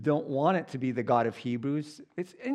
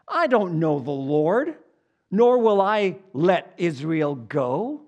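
loud, slow, haughty refusal; arrogant Pharaoh; 3 semitones higher, 6 dB louder, slower than usual. Retold or imitated. Preacher voicing Pharaoh's arrogant refusal loudly. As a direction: sneer it with royal pride. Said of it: "The arrogance in this man".

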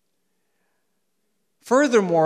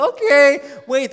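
higher and louder, as a taken-aback listener; listener's startled okay; 10 semitones higher, 12 dB louder than usual. Acted. Congregant reacting to a smiling rebuke, startled. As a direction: answer high and loud through a smile. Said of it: "you're smiling back at me going".

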